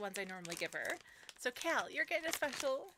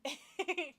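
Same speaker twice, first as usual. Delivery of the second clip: amused, raised laugh; 6 semitones higher, 5 dB louder than usual. Real, not acted. Streamer amused by her dog, laughing. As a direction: burst into a short, louder laugh.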